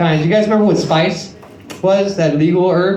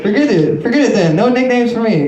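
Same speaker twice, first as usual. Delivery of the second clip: normal talking voice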